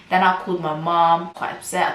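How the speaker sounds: ordinary talk, nothing marked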